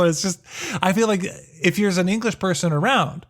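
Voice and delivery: ordinary delivery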